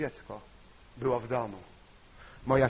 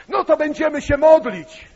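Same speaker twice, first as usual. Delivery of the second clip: shouting with sudden zeal; loud exclamation; 12 semitones higher, 14 dB louder than usual. Retold or imitated. Preacher to sobbing sister, loud zealous cry. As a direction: blurt it out loud and high. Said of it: "Bo ja z takim czymś wyskoczyłem".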